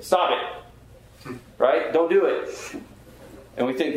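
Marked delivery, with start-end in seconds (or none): 0.0-1.4 lightly voiced Bob Newhart deadpan; a hint of Newhart telling them to stop; Bob Newhart bit. Pastor mimicking Newhart to a struggling sinner, mild deadpan. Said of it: "the Bob Newhart idea". 1.9-2.7 Bob Newhart bit. Retold or imitated. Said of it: "the Bob Newhart idea".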